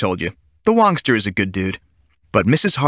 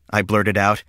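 usual speaking voice

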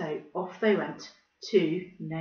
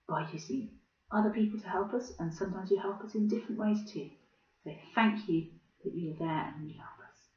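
soft quiet prayer; gentle prayer; 2 semitones lower, 5 dB quieter than usual. Acted. Closing the children's session with a prayer, gentle and soft. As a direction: pray softly and simply.

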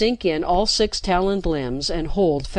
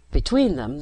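ordinary delivery

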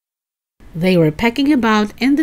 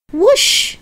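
a squawky, much higher shriek; shrieking chicken; 5 dB louder than usual. A narrator's reading. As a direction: shriek like a startled hen. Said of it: "shrieked the chicken".

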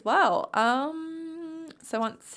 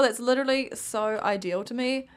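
ordinary talk, nothing marked

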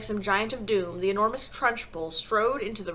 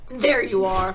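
loud gloating exclamation; forceful and victorious; triumphant cry; 8 dB louder, slower than usual. A narrator's reading. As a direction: pounce on the mistake. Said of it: "cried the Trunchbull, triumphant".

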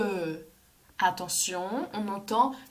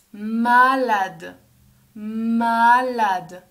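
long hummed m and drawn-out first vowel, loud; stretched-out word; 7 dB louder, much slower than usual. Shown as a demonstration. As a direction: draw the word out for young learners. Teacher models word for children.

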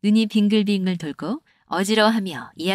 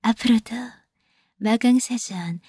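pleading maiden. Pretend. Host plays a pitiful maiden begging viewers for love. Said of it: "기구한 팔자의 이 옥녀를".

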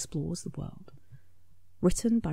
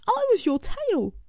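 Smudge calling; 4 dB louder than usual. A narrator's reading. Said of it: "called Smudge".

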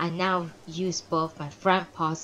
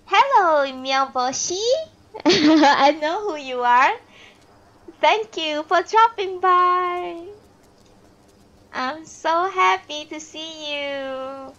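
excited greeting; 9 semitones higher, 6 dB louder, slower than usual. Real, not acted. Greets a visitor mid-reading.

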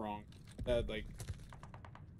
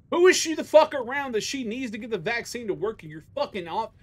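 higher and louder, heated; angry rant; 7 semitones higher, 4 dB louder than usual. Real, not acted. Fuming to chat about his mom's job, angry. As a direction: let the anger boil over. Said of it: "I have anger issues".

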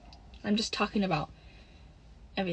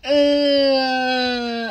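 short frustrated yell; 3 semitones higher, 4 dB louder than usual. Real, not acted. Chat tells her to scream now.

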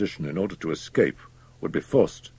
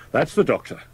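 higher, lightly called out; landlord's cry; 9 semitones higher than usual. A narrator's reading. Landlord alerting the guests, crying out. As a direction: call it out at a raised pitch. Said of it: "cried the landlord".

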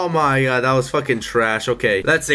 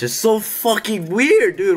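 usual speaking voice

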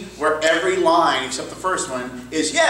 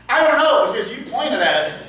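exasperated retort; 7 semitones higher than usual. Acted. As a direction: throw the question back in mock exasperation. Acted retort to suspense question.

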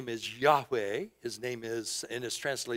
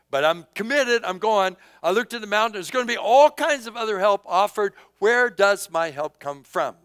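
louder, higher, determined preaching; rising resolve; 7 semitones higher, 9 dB louder than usual. Acted. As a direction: build it up as a committed pilgrim. Voicing the psalmist's resolve.

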